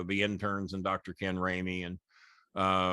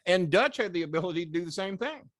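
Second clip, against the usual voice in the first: usual speaking voice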